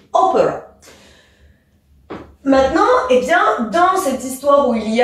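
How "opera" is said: "Opera" with the opening O insisted on; stress on first syllable. Shown as a demonstration. Teacher insists on the beginning, first syllable stressed. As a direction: hit the O of "Opera", let the rest fall. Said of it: "bien insister sur le début".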